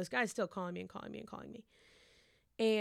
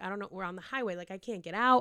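ordinary delivery

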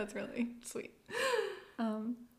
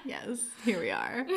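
slow sultry radio voice; sultry narrator; 3 semitones higher than usual. Pretend. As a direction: purr it like a late-night DJ. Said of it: "I want that sultry voice".

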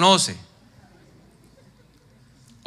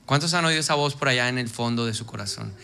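normal talking voice

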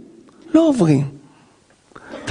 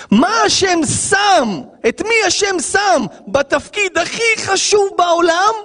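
preaching shout; 8 semitones higher than usual. Real, not acted. Preacher's rhetorical climax.

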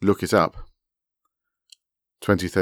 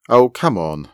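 higher, louder protest; retorting; 4 semitones higher, 7 dB louder, slower than usual. A narrator's reading. Begonia answering the woman who quoted her, retorting. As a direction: retort with exasperation. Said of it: "Begonia retorted".

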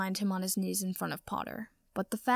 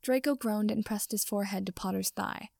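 ordinary delivery, nothing marked

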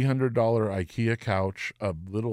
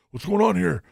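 barking guards; 10 semitones higher, 6 dB louder than usual. Retold or imitated. Security guards burst in.

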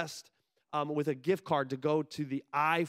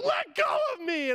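very high-pitched frantic cry; panicked mock yell. Flyer to instructor grabbing his waist, mock yelling. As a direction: yelp it like you're being held down. Retold struggle with instructor holding him.

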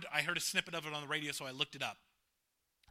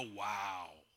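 1 mock wow; 11 semitones lower, 8 dB quieter than usual. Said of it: "they're not gonna go".

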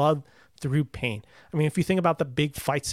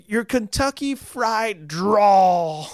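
very slow, higher mocking delivery; mock quote, dragged out and louder; quoting Chris Evans; 5 semitones higher, 5 dB louder, much slower than usual. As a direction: sneer it out slowly, the way a smug rich kid would. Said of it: "The, the Chris Evans line in the first movie".

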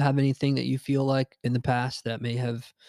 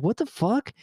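stunned disbelief; 10 semitones higher than usual. Retold or imitated. Girlfriend admits doing cocaine.

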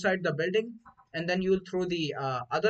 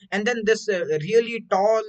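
normal talking voice

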